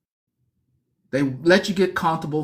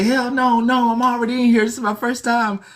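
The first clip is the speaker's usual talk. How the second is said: scared new inmate refusing; 6 semitones higher than usual. Retold or imitated. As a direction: back away from the offer in a higher voice. Refusing the cellmate's offer.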